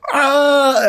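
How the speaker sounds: high, held imitation scream; freaked-out mock scream. Dad turning round to face his killers, mock scream. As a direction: let out a movie victim's scream. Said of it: "He's all freaked out".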